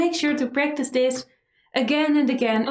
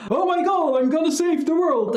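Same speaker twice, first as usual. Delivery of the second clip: mock superhero; 6 semitones higher than usual. Teacher mocking a superhero entrance with no epic sound, heroic. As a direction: puff up like a movie hero. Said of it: "the superhero to come in like".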